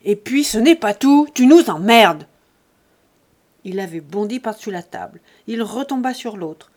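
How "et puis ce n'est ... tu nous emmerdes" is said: enraged shout. A narrator's reading. Buteau about to leap the table, enraged shout. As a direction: explode before the attack. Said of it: "Buteau, enragé ... Il reprit".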